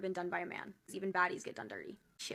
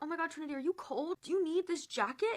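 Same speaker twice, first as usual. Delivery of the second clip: imitated fussing concern; 8 semitones higher than usual. Imitates man fussing over her.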